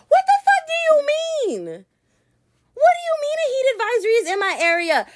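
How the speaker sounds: mock-furious high outburst; outraged yell. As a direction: blow up at the news in disbelief. Outraged retelling of the advisory.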